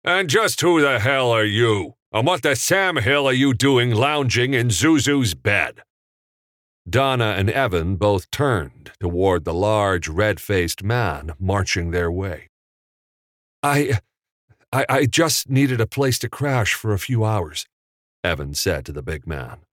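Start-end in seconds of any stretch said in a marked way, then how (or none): 0.0-5.8 higher, forceful angry challenge; thundering bellow. A narrator's reading. Big man finding a stranger in Zuzu's bed, bellowing. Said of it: "A thundering voice bellowed out". 13.6-17.7 flustered. A narrator's reading. Caught intruder explains to angry man.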